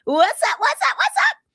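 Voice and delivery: light up as a friend pops in; excited greeting. Real, not acted. Greeting a viewer who joined.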